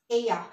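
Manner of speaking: "ella" pronounced EH-ya; double L as Y. Shown as a demonstration. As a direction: say "ella" with LL turned into a Y glide. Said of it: "double L is Y".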